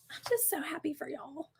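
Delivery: hushed, choked-up retelling; imitating tearful girl. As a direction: squeeze it out very softly, imitating someone near tears. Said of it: "She's like".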